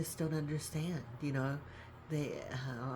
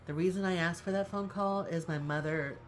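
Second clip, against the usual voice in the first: ordinary talk, nothing marked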